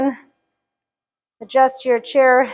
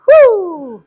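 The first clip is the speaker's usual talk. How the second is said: excited cheer; 6 semitones higher, 7 dB louder than usual. Real, not acted. Cheering after finishing a set.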